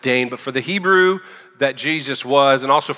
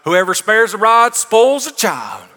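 put-on Southern drawl on the old saying, slow and higher; 7 semitones higher, 4 dB louder, slower than usual. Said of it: "how do we say it in the South?".

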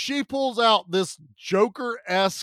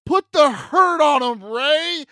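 loud, high mock yell; hammed-up granny holler; rousing old-lady cheer; 9 semitones higher, 7 dB louder than usual. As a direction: egg the hero on at full voice. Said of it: "Oh, no, she goes".

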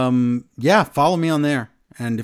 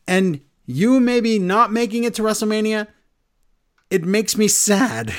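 imitating Gunther; 8 semitones higher than usual. Gunther's promo retold.

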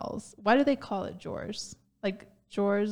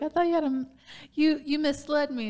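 higher-pitched mock complaint; mock indignation; 7 semitones higher than usual. Man to masked date who misled him, mock indignant. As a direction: protest as if you've been tricked.

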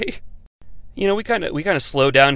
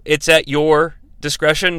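usual speaking voice

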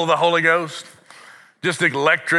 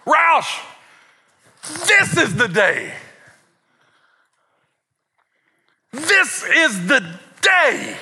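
hammed-up, slow high fervour; amped-up dad; 9 semitones higher, much slower than usual. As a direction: proclaim it like an over-excited preacher dad. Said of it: "boy, all amped up".

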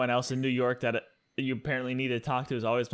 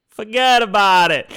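loud, high New York catchphrase holler; hammed-up New York yell; mock New York accent; 9 semitones higher, 12 dB louder than usual. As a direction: belt it like a cartoon New Yorker. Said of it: "and he goes".

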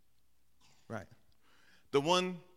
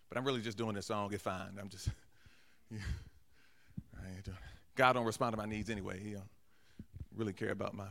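a much softer, lower aside, as if admitting a secret; muttered confession; 7 semitones lower, 5 dB quieter than usual. Pretend. Pastor voicing a churchgoer's secret doubt, in a much softer, lower mutter. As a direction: say it under your breath, half to yourself. Confessing hidden indifference, dropped voice.

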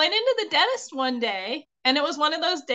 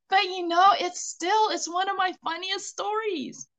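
higher, words riding on a laugh; real laugh fading into speech; fond laughter; 7 semitones higher than usual. Real, not acted. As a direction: still giggling as you wrap up. Laughing after the story.

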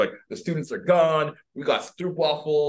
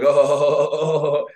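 mock laughter; 3 semitones higher, 7 dB louder than usual. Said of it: "laughing, like".

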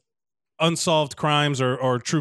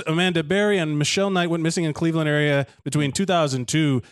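normal talking voice

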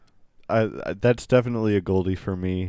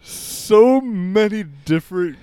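higher, louder, drawn-out disbelief; amused amazement; 7 semitones higher, 6 dB louder, slower than usual. Real, not acted. Host marvelling at a rambling clip, amused amazement. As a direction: marvel loudly, stretching the words.

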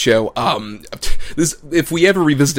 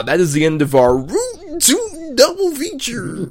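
announcer voice; 12 semitones higher, slower than usual. Pretend. Mock announcer wrap-up.

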